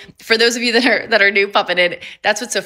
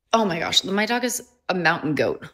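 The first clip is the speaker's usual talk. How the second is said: usual speaking voice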